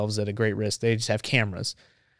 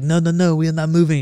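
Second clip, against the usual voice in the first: put-on high, louder protest with a French accent; bad French accent; 6 semitones higher, 5 dB louder than usual. French reporter refusing the Marine's order to move, panicky French accent. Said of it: "it's a bad French accent".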